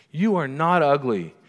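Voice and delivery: firm motherly insistence; emphatic coaching mother. Retold or imitated. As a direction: press it home to a hurting child. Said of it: "she's coaching him".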